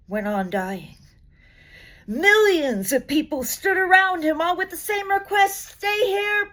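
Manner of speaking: storytelling recital, rising as the crowds grow; poetry reading. Acted. Reading Masses to an audience, swelling recital.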